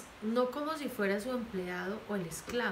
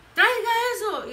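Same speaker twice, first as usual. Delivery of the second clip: much higher, loud barked order; parent yelling; 12 dB louder than usual. Retold or imitated. Parent ordering their child around, loud yell. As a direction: yell the order at your kid. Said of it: "y los gritan".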